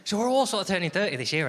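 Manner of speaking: normal talking voice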